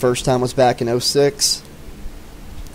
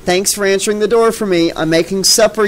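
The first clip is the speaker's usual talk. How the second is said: raised-pitch shout at no great volume; mild narrated shout; 7 semitones higher, 3 dB louder than usual. A narrator's reading. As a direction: call toward the living room, lightly exasperated. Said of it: "They heard her shout".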